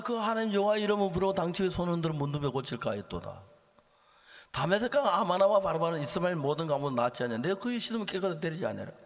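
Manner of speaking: loud, rapid indignant recital; heated preacher's quotation; worked-up anger. Acted. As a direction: declaim it like a man fuming from the pulpit. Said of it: "이제 한참 열나가지고 그 생각이 들었어요".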